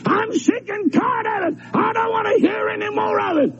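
fed-up sinner throwing a fit; pitching a fit. Retold or imitated. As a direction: act out a sinner's tantrum. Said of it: "You pitch a fit and say".

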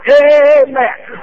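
loud, high amen; shouted amen. Real, not acted. Preacher to congregation, a loud high amen. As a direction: punch it out to the congregation.

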